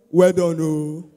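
low, slow, loud hail; exaggerated drawn-out commendation; mock passer-by greeting. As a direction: drag it out deep and loud. Imitates passers-by greeting suited worker.

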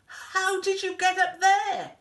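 high, loud amazed question; startled high-pitched exclamation; astonished. A narrator's reading. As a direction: cry it out in disbelief. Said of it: "gasped Elmer".

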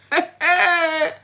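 high, loud burst of laughter. Real, not acted. Speaker laughs at the island's wisdom, high and loud. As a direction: laugh hard at the revelation.

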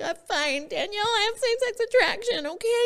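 a nervous, high rush; blurted confession. Retold or imitated. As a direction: blurt it out to get it over with. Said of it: "and I was like".

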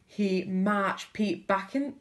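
usual speaking voice

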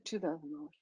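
barely audible, low mumbled price; very soft and low, acted-out timidity; timid mumble. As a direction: swallow the words, hoping not to be heard. Timidly repeats her price when challenged.

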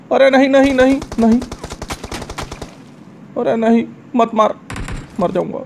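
panicked shout. Real, not acted. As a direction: yell for cover fire in panic. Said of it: "panic situation थी".

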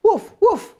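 high yappy woofs; puppy barking. Acted. Storyteller voicing Biscuit answering the girl, high puppy barks. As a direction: bark like a tiny puppy.

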